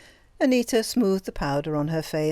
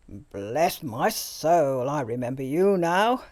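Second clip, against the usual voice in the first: put-on jovial old doctor; slower than usual. A narrator's reading. The old doctor greeting Greta, jovial and hearty. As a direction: boom out the greeting with fussy cheer.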